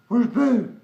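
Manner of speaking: 1 sing-song call. Real, not acted. Calling for the cat.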